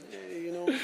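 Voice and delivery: Bob De Niro. Shown as a demonstration. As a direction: toss off a soft little film-star impression. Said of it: "I did my Bob De Niro impression".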